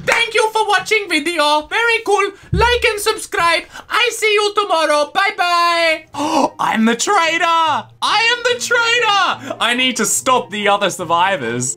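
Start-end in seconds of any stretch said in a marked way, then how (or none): 0.1-6.1 high-pitched, choppy parody outro; mock video sign-off. Mock video sign-off as the traitor falls. 6.6-9.6 gasp, then high excited announcement; thrilled realisation. Real, not acted. Discovers they are the traitor.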